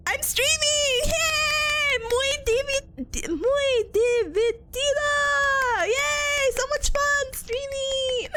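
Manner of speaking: high, drawn-out gleeful cheer; playful cheering. Pretend. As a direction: celebrate in a high voice, stretching the words. Cheering about streaming in broken Spanish.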